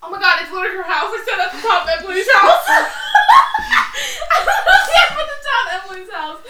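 loud, high, incredulous outburst; laughing exasperation. Real, not acted. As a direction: protest loudly while cracking up. Real exasperation at co-host, laughing.

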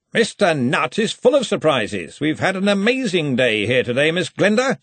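high, hearty, slightly menacing cheer; jovial boss with an edge; threatening joviality. A narrator's reading. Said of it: "the same slightly threatening joviality".

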